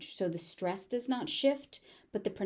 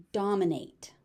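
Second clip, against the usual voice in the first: usual speaking voice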